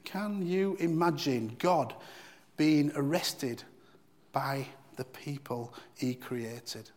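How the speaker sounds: slow, weighty rhetorical question; slow and emphatic. Real, not acted. Preacher to congregation, slow and weighty. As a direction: let each phrase land slowly. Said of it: "slow emphatic rhetorical question".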